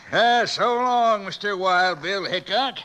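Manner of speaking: villain taunts the buried marshal; mocking farewell. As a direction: drag it out to savour the win. Villain taunts the marshal he thinks is dead.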